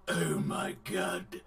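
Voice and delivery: low, slow whispered gasp; hushed low mock despair; devastated whisper. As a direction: breathe it out crushed. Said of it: "he's like".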